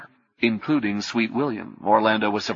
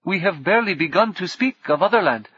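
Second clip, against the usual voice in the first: high, lilting calm question; 9 semitones higher than usual. Acted. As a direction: ask it evenly amid the chaos. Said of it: "his lilting voice".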